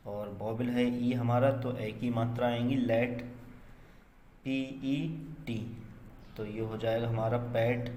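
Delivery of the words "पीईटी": spelled out. Shown as a demonstration. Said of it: "spelling a word letter by letter".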